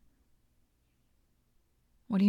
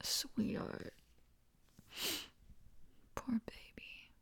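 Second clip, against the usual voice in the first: faint whisper, much softer; tender whisper; 3 semitones higher, 13 dB quieter, slower than usual. Acted. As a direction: barely breathe it, consoling him.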